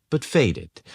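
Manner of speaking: plain reading voice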